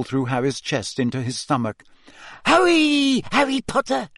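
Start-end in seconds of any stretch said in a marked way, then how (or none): 2.5-4.1 very high, squeaky elf voice; squeaky house-elf. Acted. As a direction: squeak it like a tiny anxious elf. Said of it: "squeaked Dobby".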